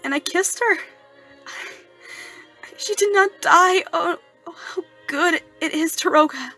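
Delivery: high, loud, overcome outburst; tearful joy. Acted. Erik telling Daroga of his first kiss, tearfully overjoyed. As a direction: break into tearful rapture.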